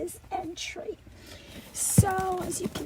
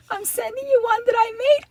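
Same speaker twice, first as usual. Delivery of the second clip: ordinary talk, nothing marked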